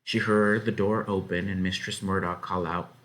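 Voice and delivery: usual speaking voice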